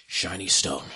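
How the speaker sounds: low, covetous monster voice; low creature voice; hungry stone-eating creature. Acted. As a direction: murmur it deep as a gem-hungry beast. Game master voices the creature.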